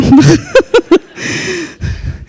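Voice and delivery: high, long falling laugh; real high-pitched apologetic laughter; embarrassed laugh. Real, not acted. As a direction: laugh at your own slip. Laughs at own mispronunciation.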